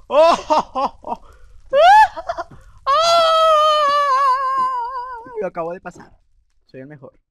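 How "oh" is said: high, loud laughing shout; triumphant laughter. Real, not acted. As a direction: burst out laughing in victory. Finishing the maze.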